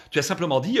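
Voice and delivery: ordinary talk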